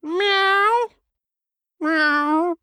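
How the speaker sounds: high, mewling cat meow. Acted. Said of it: "not your cat voice again".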